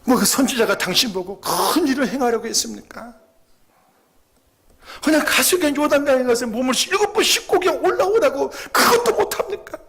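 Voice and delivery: fervent preaching. Real, not acted. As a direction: preach it hard, rising to a cry. Preacher presses the point. (설교하듯 높고 크게 외치며)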